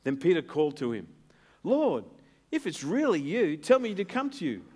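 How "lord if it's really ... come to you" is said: calling out. Retold or imitated. Preacher voices Peter calling out.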